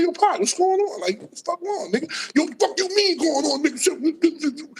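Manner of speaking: mock-heated street argument; retold heated confrontation. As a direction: replay the tense back-and-forth, wound up.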